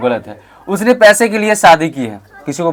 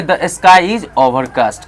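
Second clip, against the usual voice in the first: slow, careful model sentence; slow model line; much slower than usual. Shown as a demonstration. Teacher models the line slowly for students to repeat. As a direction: spell it out slowly for the class. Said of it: "क्या बोलेंगे?".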